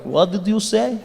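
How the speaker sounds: put-on lightly menacing Russian accent. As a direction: challenge them in a Russian accent. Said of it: "And the Russians were like".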